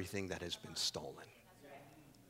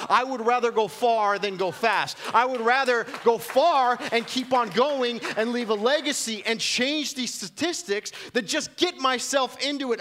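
higher, louder, climbing pulpit passion; fired-up sermon climax; full-force preaching; 12 semitones higher, 7 dB louder than usual. Acted. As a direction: drive the point home to the congregation.

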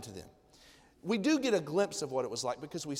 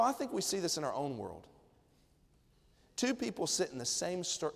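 normal talking voice

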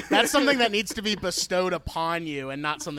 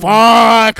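shouted curse word; loud curse; 5 semitones higher, 20 dB louder than usual. Retold or imitated. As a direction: yell it out in frustration. Acted exasperated outburst.

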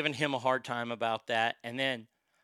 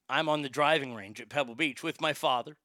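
usual speaking voice

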